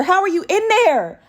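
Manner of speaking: real shock at the snake; amazed disbelief. Real, not acted. Asks snake in drawer.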